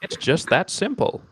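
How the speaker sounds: slow salesy pitch with a laugh; infomercial voice. Acted. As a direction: sell it like a TV ad. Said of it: "I sound like an infomercial".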